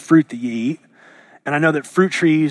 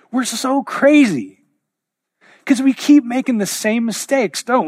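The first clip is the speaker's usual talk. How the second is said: emphatic exclaim; 7 semitones higher, 3 dB louder than usual. Real, not acted. Preacher exclaiming about human folly.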